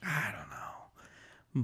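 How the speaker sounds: slow breathy whisper aside. Real, not acted. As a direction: whisper it to yourself. Muttered aside while talking.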